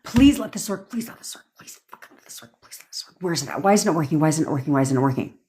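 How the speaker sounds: fading into a breathless whisper; hushed frantic begging; mock desperate pleading. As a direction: beg and whisper in panic. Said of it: "It's like a".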